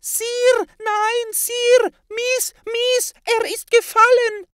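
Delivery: very high, loud squeaky shriek; tiny servant squealing that his master fell; squeaky house-elf. A narrator's reading. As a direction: shriek it like a panicking little elf.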